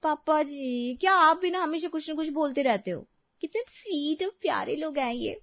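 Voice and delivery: high, pleading complaint; protesting daughter-in-law. Acted. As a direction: gently scold father-in-law in a high voice.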